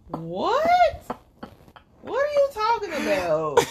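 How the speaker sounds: squeaky disbelieving question; 1 mock confused. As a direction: teller confused by northern slang. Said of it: "I'm just like".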